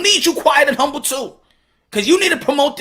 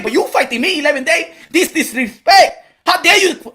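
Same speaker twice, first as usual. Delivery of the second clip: loud, higher mock Cuban fighter trash talk; imitating Ugas; 4 semitones higher, 3 dB louder than usual. Comedian voicing Ugas taunting Pacquiao, loud and high. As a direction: hype it up like a fighter who's sick of the smiling. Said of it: "we need Ugas to be like".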